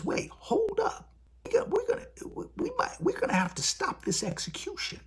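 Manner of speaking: a judge suddenly panicking, voiced way up high; mock judge. Judge stopping an execution an hour out, in a high mock voice.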